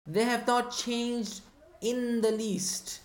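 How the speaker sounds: not and in the least drawn out slowly; slow emphatic phrase. Shown as a demonstration. Teacher stressing the translation to students, slow and emphatic. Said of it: "Look at what the tra".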